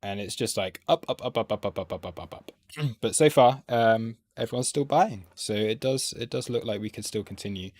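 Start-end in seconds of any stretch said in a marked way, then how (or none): 0.9-2.5 mimicking chart ticks. Trader mimics the chart climbing, rapid fading patter. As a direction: rattle them off evenly, fading out.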